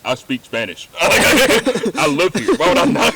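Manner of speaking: incredulous native, laughing it off; laughing indignation. Retold or imitated. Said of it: "And they're like".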